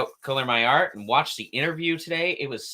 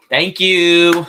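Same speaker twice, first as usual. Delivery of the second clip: loud, raised, drawn-out thank-you; showy thanks; 7 semitones higher, 13 dB louder than usual. Pretend. Artist thanking the audience, loud playful call. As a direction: call it out to a crowd. Thanks viewers, clapping for self.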